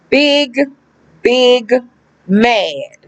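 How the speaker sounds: "Big, big mad" with a pause after each word; drawn-out emphasis. Real, not acted. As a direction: land "Big... big... mad" one word at a time. Drives home how mad they were.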